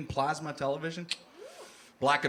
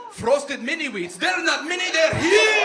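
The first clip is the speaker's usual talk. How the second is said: loud, high, slow ad pitch; yelling cereal commercial; ad shout; 11 semitones higher, 9 dB louder, slower than usual. Acted. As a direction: bellow it like a TV ad. Mocking TV cereal ad.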